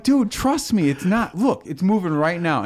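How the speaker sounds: high, insistent innocent protest; mock-earnest reassurance to a victim; feigned sincerity. Feigns innocence while secretly moving mouse.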